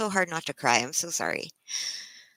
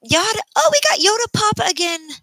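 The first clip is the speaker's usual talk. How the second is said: excited greeting; 10 dB louder than usual. Real, not acted. Greeting a returning viewer on stream.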